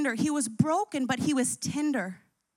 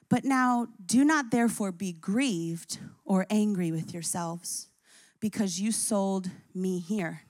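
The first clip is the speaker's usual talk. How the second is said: gentle reassurance; 6 semitones lower than usual. Acted. Joseph comforting his brothers.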